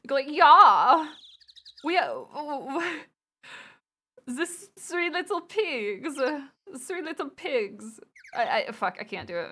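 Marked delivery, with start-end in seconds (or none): 0.3-8.0 put-on funny German accent, higher and drawn out; flamboyant, slow cartoon German; pigs from Shrek. Streamer showing chat a funny German voice, flamboyant accent. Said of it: "like the pigs from, from Shrek? They go like".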